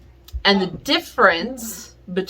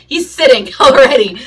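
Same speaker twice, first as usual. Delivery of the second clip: laughing; 3 semitones higher, 7 dB louder than usual. Real, not acted. Laughing at eager dog.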